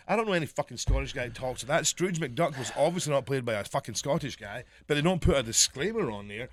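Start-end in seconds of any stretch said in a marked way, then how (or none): none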